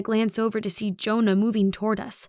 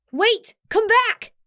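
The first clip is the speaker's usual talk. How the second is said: high, loud yell; high-pitched pleading holler; desperate shout; 13 semitones higher, 5 dB louder, slower than usual. A narrator's reading. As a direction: yell after someone getting away. Running after the departing truck.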